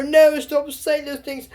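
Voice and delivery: a mild mock hurt plea; upset young Cedric. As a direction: beg them to stop, hurt.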